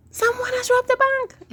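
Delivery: very high, loud alarmed shout; crowd shouting. Acted. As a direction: yell it as a panicked crowd. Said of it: "everyone shouted".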